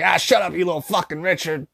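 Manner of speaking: high, crackly grandma brush-off; cranky old lady. Retold or imitated. Grandma nicknaming her grandson Richard, cranky old lady voice. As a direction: imitate a cussing old grandma shooing someone off. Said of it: "so she's like".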